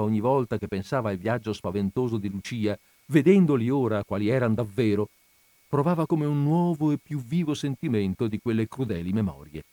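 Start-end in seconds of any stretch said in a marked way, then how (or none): none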